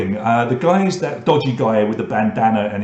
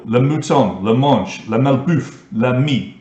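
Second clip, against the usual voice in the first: best attempt at a French accent; slower than usual. Shown as a demonstration. Quiz host reading French answer choices to players, French accent. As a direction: put on a French accent for each option. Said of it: "That is my best French, French accent for you".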